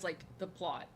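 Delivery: ordinary delivery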